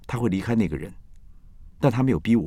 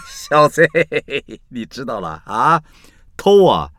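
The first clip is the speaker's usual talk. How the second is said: teasing friend; 6 semitones higher, 8 dB louder, slower than usual. Retold or imitated. Mocking a friend after laughing.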